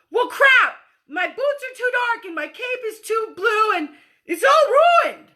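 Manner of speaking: high, loud whiny complaint; whiny kid. Acted. Kid at the playground complaining about boots and cape. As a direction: sulk like a kid whose costume is ruined. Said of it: "no kid shows up to the playground and says".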